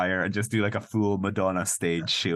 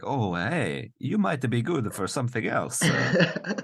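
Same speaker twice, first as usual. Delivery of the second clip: higher, hammed-up Italian accent; 7 semitones higher than usual. Man reconsidering women's uses, mock Italian accent. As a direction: muse aloud as a sexist Italian man. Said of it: "That's where he's like".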